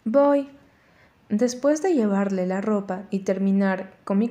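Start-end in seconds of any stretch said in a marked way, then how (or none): 0.0-0.5 higher, louder shout back; calling back. Acted. Daughter shouting back to her mother, higher louder. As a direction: yell it back quickly. Answering mom's yell.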